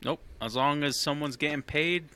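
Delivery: ordinary talk, nothing marked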